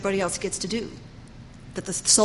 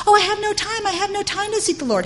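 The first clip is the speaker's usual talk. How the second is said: mock whiny excuse; 11 semitones higher, 3 dB louder than usual. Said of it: "the soul's excuse mocked".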